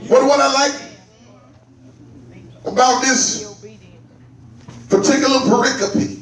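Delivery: drawn-out, intoned preacher's cadence; musical preaching. Real, not acted. Preacher working up the congregation over a favourite passage, chanted preaching. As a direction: stretch the key words into long held notes.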